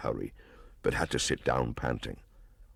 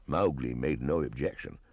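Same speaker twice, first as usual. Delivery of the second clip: ordinary delivery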